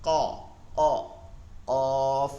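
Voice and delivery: "ক, অ, অফ" with a heavy, rounded sound; thick and heavy. Shown as a demonstration. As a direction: hold "অফ" full and heavy. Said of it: "হরফের উচ্চারণ মোটা ও ভারী আওয়াজ". ("অফ" মোটা ও ভারী আওয়াজে)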